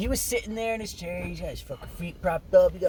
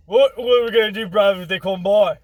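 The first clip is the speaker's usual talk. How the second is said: loud cockney C-3PO, higher; British accent; 4 semitones higher, 8 dB louder than usual. Acted. C-3PO asking what to do, loud cockney accent. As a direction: play a panicky London droid. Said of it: "I love that voice".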